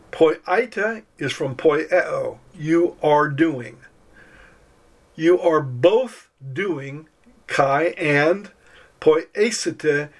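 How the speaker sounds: slow, deliberate teaching pace; slow explaining. Shown as a demonstration. Teacher explaining the verse to students, slowly. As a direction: spell out the meaning slowly. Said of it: "Here's an example".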